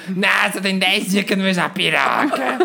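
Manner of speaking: put-on raspy old aunt who smokes, with a laugh; hoarse smoker voice. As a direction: rasp it like a chain smoker. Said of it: "com a voz rouca". (voz rouca de fumante)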